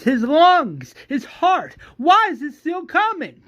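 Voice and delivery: high, loud, insistent cries; yelled challenge. Acted. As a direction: hammer each point, pitch climbing. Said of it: "that's where he says".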